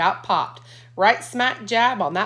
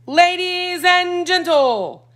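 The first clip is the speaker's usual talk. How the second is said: high, loud, slow showman call; teasing announcer; 11 semitones higher, 7 dB louder, much slower than usual. A narrator's reading. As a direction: tease them like a ringmaster. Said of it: "going to tease them".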